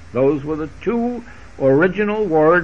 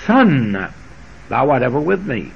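SON held alone, then the rest quicker and lower; stress on SON; 3 semitones lower than usual. Shown as a demonstration. Preacher showing listeners they are called son, stress on "Son". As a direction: set "Son" apart, hurry through "thou art ever with me". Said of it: "we could emphasize every word".

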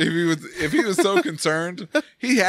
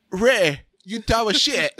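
higher-pitched mock-British ribbing; British accent; 5 semitones higher, slower than usual. British friend scolding his attempted accent, imitated British accent. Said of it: "I had one British friend".

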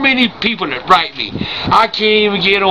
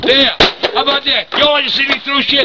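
higher-pitched angry taunting; destructive tantrum; 5 semitones higher than usual. Pretend. Grandpa breaking things in front of the camera, angry tantrum. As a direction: taunt them as you throw a fit.

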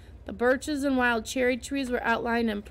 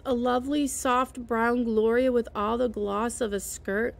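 plain reading voice

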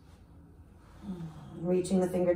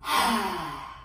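forceful breathy exhale, tongue out; lion's breath exhale; 2 semitones lower than usual. Shown as a demonstration. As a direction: let it all out like a roaring lion. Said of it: "Last time".

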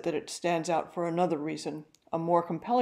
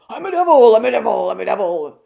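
high, loud cawing raven voice, hoarse and far off; hoarse distant raven; 5 semitones higher, 15 dB louder than usual. A narrator's reading. Raven Grip crows at the startled locksmith, hoarse, distant and loud. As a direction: croak it out like a devilish talking bird. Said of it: "in a voice so hoarse and distant".

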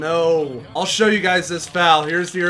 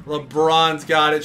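ordinary talk, nothing marked

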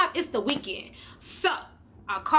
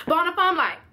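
usual speaking voice

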